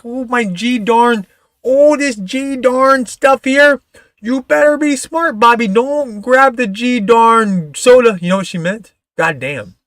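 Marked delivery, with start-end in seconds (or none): 0.0-8.2 put-on high, slow Fargo mom accent, Minnesota; drawn-out high Minnesota mom scolding Bobby. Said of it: "She talked like this, like she's from Fargo".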